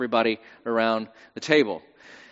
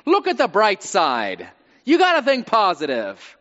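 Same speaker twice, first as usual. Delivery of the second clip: high, loud, mock pep talk; hammed-up cheerleading, stretched out at first; forced positivity; 5 semitones higher, 5 dB louder than usual. Somebody talking you out of your pain, relentlessly upbeat. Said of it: "try to convince you that you shouldn't be in pain".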